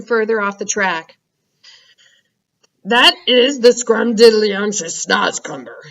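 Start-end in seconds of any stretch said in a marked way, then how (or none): 2.8-5.8 slow, gentle giant voice; friendly giant BFG. Acted. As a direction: savour each word like a kind giant.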